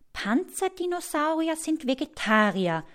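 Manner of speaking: put-on high, musing girl's voice; little researcher working it out; pondering girl. A narrator's reading. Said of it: "überlegt Mina".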